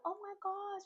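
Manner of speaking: a high, hushed gasp of shock. Retold or imitated. Reviewer reacting to Dylan's news, high and hushed. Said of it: "And I'm like".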